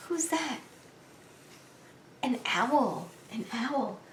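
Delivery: soft, half-whispered question and answer; hushed aside. Real, not acted. Reader asks listeners who is there, hushed. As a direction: drop to a near whisper.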